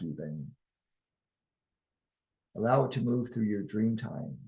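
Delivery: calm, measured guiding voice with long pauses; guided meditation. Acted. Guide leading listeners into oneness and harmony, calm guided meditation. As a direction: lead them unhurriedly through the imagery.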